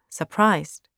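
plain reading voice

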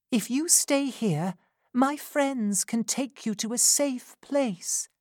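pleading Rose. A narrator's reading. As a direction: ask her not to go back. Rose offering help.

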